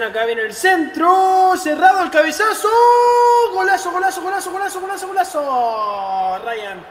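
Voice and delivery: breakneck goal commentary. Acted. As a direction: shout the header in. Goal call.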